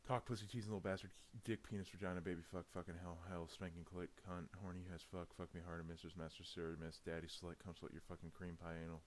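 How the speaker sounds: racing through the list in one breath; fast, combining words together; fast run-together read. Shown as a demonstration. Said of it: "I have to try it just for the sake of it".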